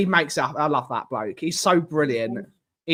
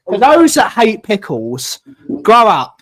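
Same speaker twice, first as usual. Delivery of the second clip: mock scolding; 2 semitones higher, 7 dB louder than usual. Real, not acted. Scolding pickle haters.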